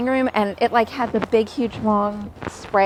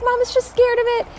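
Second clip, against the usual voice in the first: put-on much higher, coaxing comfort for a crying baby; calm the frightened little one; mother hushing her crying toddler, pitched way up; high soothing to baby; 14 semitones higher, 3 dB louder than usual.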